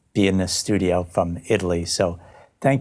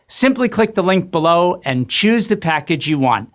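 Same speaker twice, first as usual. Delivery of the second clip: higher, upbeat scripted sign-off; presenter's promo outro; 9 semitones higher, 5 dB louder than usual. Acted. Host closing day five of the docu-class, promotional. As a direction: sell the next episodes to the viewers.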